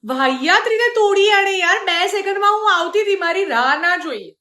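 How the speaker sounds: replaying her irritation over the broken clock; retold anger. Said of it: "મારો ગુસ્સો તો એવો હતો".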